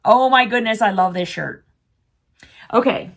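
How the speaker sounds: ordinary talk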